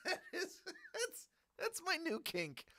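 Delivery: high giggly repeat; giggling at own joke. Real, not acted. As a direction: laugh it out up high.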